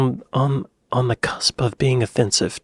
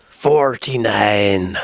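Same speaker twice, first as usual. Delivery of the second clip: slow, drawn-out Bristol accent imitation; 2 semitones lower, 3 dB louder, much slower than usual. Echoing a Bristol man from the clip, in a Bristol accent. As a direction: stretch it out like a Bristolian. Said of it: "It's fun to talk like that".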